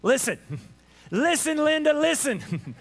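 sassy, drawn-out scolding; mock-exasperated plea to pay attention; sarcastic. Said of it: "slightest bit of sarcasm here".

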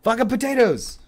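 shouting. Real, not acted. Yelling about potatoes.

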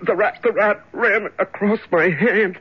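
high, alarmed, breathless fright; startled fright. Acted. Prisoner in the dark feels a rat, frightened. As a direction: recoil as something brushes your hand. Rat runs across his hand.

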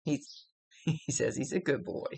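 laughing as she relays it; tickled, giggling through the words. Real, not acted. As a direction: break into a chuckle mid-sentence. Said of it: "I'm laughing at the way he said it".

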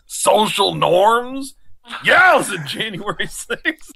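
very slow, deliberate delivery; slow drawn-out punchline. Acted. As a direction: stretch every word out. Repeats the joke, slowed.

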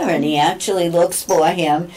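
usual speaking voice

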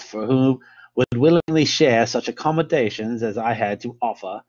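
hammed-up amateur English accent, lilting. Lecturer reading Fitzroy's account to listeners, in an English accent. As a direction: read it out in your best English accent. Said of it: "best English accent".

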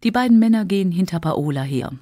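plain reading voice

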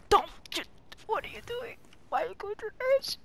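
high, fast alarmed protest; shocked yelp at teammate; high disbelieving outcry. Real, not acted.

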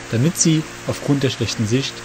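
plain reading voice

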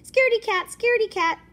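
high, loud sing-song scaredy cat; sing-song taunt. Acted. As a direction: chant it to mock him.

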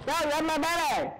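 put-on high, over-the-top cries of my baby; mock frantic parent; hammed-up cry. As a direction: shout it like a frantic parent, for laughs.

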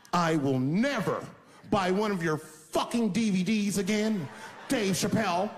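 angry parting shot. Retold or imitated. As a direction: spit the last dig on the way out. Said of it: "take one last dig at me".

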